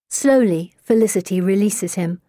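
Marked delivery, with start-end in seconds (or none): none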